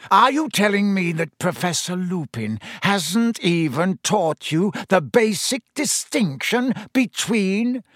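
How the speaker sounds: mock-shocked teacher; scornful disbelief. A narrator's reading. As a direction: drip with scorn. Snape scornful.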